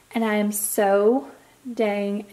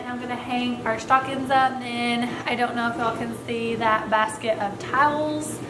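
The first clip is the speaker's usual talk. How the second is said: ordinary talk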